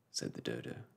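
ordinary delivery

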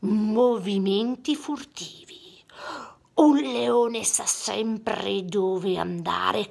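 slow low whisper; hushed teacher lesson; whispered lion master. Acted.

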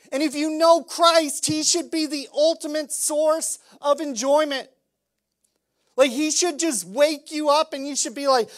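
loud, high, driving sermon voice; fiery preaching. Acted. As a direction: preach it hard to the congregation. Preaching at full force.

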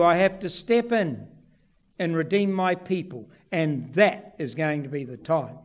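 ordinary talk, nothing marked

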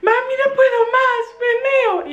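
put-on high pleading kid voice; whiny child. Daughter begs her mother in the queue, high whine. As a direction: beg like a desperate little girl. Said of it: "aquello es".

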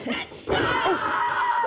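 high loud laugh out; burst of laughter. Real, not acted. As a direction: crack up at the joke. Co-host laughs at own joke.

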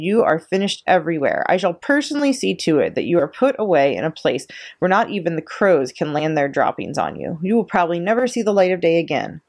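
plain reading voice

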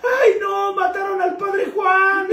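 high, drawn-out mock lament; horrified wailing shout. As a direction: wail it like a horrified mother superior. Horrified mother superior delivers punchline.

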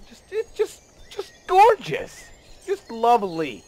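high squeal of admiration; gushing. Real, not acted. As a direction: swoon over it in a very high voice. Gushing over the beautiful water.

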